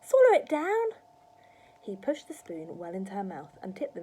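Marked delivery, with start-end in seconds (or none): 0.0-0.9 put-on high, loud boyish urging; cried out. A narrator's reading. George urging Grandma to swallow, crying out. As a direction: call it out to urge her on. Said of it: "George cried out".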